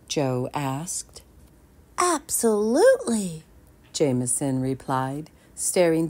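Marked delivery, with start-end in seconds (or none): 2.0-3.4 put-on smitten boy. Jameson answers, smitten.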